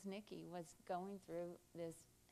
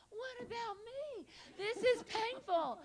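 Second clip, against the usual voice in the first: very high, slow, drawn-out whine; mock crying wail; 5 dB louder, slower than usual. Another actress wanting comfort too, high mock wail. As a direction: wail it high, hammed-up agony. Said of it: "Everybody's crying".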